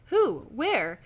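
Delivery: acted startled reaction; startled questions. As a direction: fire out two alarmed questions. Startled reply in the story.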